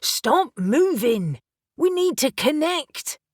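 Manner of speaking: urgent plea. A narrator's reading. Reuben pleading mid-fight.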